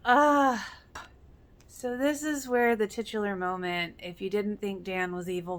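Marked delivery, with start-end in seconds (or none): 0.0-0.7 loud, higher exclamation of dismay; anguished cry. Real, not acted. Viewer remembering Jimmy's death, dismayed cry. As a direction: gasp it out in shock.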